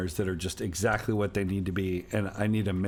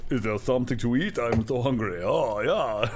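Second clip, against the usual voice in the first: put-on German accent. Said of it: "spot on".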